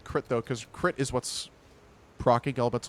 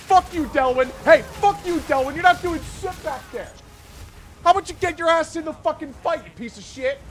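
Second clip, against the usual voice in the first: high, full-volume cursing tirade; angry yelling; 13 semitones higher, 8 dB louder than usual. Pretend. Gamer cussing out Delwyn to get into the fight, angry yelling. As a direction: yell abuse at a slacking teammate.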